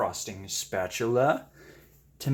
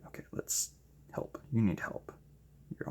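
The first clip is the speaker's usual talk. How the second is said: whisper; 2 semitones lower, 11 dB quieter than usual. Real, not acted. Coaxing the paint quietly.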